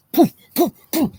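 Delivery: high, sharp "poof" punch sounds; high mock punch noise. Mimics a blow landing.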